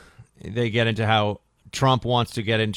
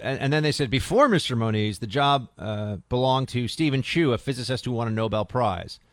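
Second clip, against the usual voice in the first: ordinary delivery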